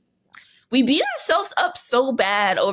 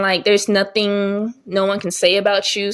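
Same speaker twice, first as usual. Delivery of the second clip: normal talking voice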